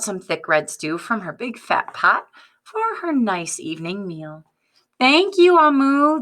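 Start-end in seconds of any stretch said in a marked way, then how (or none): 5.0-6.2 high, loud happy thanks; grateful boy. Acted. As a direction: thank her brightly like a child. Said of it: "the little boy said".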